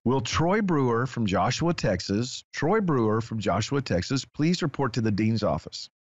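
ordinary talk, nothing marked

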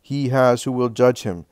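solemn, declaiming sermon voice; preaching. Acted. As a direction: proclaim it like a preacher. Jesus preaching to crowd.

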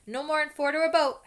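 big friendly giant calling first years. A narrator's reading. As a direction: call the crowd over. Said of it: "Hagrid called".

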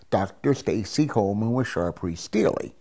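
ordinary talk

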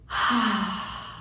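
long releasing sigh out; big audible sigh. Shown as a demonstration. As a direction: let the breath go in one loud sigh. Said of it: "releasing it with a big, loud, audible sigh".

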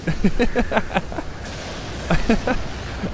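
high, wheezy giggle; laugh. Real, not acted. Player cracks up at the teasing waves, giggling. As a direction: giggle helplessly at your own joke. Amused at own wave joke.

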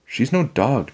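put-on mildly indignant girl; objecting. A narrator's reading. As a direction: object to his silly advice. Annie objects to Jack.

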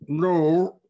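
loud character voice for a fat gentleman; great fat man. A narrator's reading. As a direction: boom it out as a big man. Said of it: "said a great fat man".

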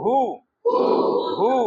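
হু said with both lips rounded. Shown as a demonstration. Teacher models হু for the class to repeat, rounded lips. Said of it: "দুই ঠোঁট গোল করে বলেন".

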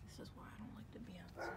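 fast, half-whispered grumble; rushed hushed grumble; hushed complaint. Real, not acted. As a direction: mutter it under your breath quickly. Whispered complaint about outdoors.

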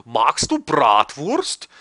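put-on voice. Shown as a demonstration. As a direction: drag it out in a silly voice.

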